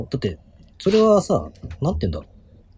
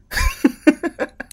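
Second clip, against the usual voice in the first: high laugh. Real, not acted.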